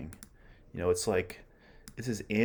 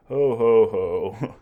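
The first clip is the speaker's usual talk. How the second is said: a loud, hearty burst of ho ho ho; booming ho ho ho; 3 semitones higher, 12 dB louder than usual. Acted. Antony shaking off his gloom to his friends, loud hearty ho ho ho. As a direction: boom it out like a rousing laugh-cry. Antony's outburst read aloud.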